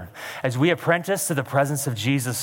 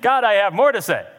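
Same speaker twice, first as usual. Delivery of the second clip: higher, insistent protest to God; raised-pitch, assertive objection; standing his ground; 6 semitones higher, 4 dB louder than usual. Acted. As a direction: speak up and hold your ground. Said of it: "standing his ground and saying".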